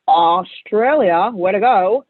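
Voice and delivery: put-on slow, drawn-out Australian accent on the country name; a light, playful Aussie accent. Reading wage statistics to the host in a mock Australian accent. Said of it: "Sorry for that accent".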